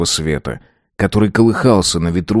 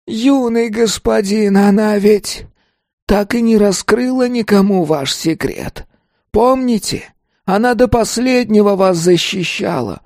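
desperate pleading spirit; 14 semitones higher than usual. A narrator's reading. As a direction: plead with all remaining strength. Ghost's long desperate plea.

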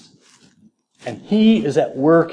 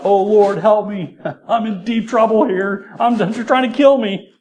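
high-pitched mock distress; hammed-up desperate plea; 7 semitones higher than usual. Preacher acts out David crying to the Lord, mock-desperate. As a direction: plead for help in a raised, put-on panic.

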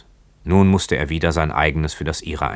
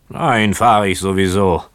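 put-on loud, higher drunken retort; drunken boatswain; 4 semitones higher, 6 dB louder than usual. A narrator's reading. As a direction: toss it back like a defiant drunk. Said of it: "wie die eines gänzlich Betrunkenen klang".

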